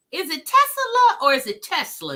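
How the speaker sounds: "Tesla" first stretched to Tes-uh-la, then said Tes-la; two pronunciations compared. Shown as a demonstration. Host asks viewers how to say "Tesla", contrasting both ways. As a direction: weigh "Tesla" as three syllables against "Tesla" as two. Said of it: "Uh, two syllables".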